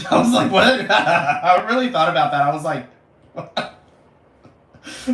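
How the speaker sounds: giggly disbelief at her own idea; laughing. Real, not acted.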